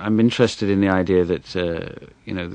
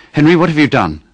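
higher, louder alarmed scolding; worked-up mum; 9 semitones higher, 12 dB louder than usual. Acted. Mum rushing in, red and worked up, scolding her son. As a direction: scold him, flustered and upset. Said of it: "all red and worked up".

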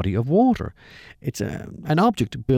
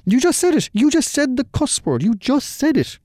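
much higher and louder mock shriek; retold shriek; 3 dB louder than usual. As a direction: shriek it back in mock shock. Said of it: "she shrieks back at me".

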